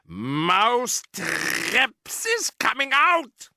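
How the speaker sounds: high, slow witch voice; a sinister foreign witch boss; Grand High Witch. Acted.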